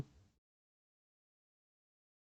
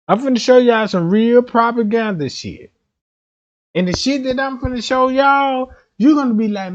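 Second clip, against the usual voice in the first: higher and louder hype; hyped up; 6 semitones higher, 5 dB louder than usual. Real, not acted. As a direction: sell the big reveal. Hypes coming reveal.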